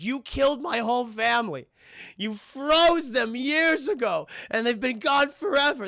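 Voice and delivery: high, hammed-up anguished outcry; imitating a grief-stricken accuser; tearful accusation. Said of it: "he's crying".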